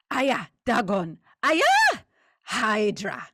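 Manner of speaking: shouted chant with a shrieking high peak; ritual shout. Acted. Creature ending the oath, ecstatic shouted chant. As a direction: cry out to the gods at full voice.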